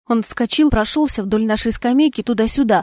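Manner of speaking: plain reading voice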